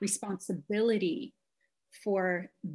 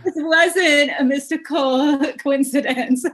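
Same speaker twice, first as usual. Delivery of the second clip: laughing; 7 semitones higher, 12 dB louder than usual. Real, not acted. Amused at the coincidence.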